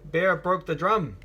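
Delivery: usual speaking voice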